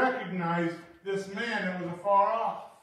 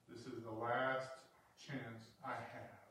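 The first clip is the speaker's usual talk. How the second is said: quiet inner thought; 11 semitones lower, 16 dB quieter than usual. Acted. Lepers' inner thought quoted.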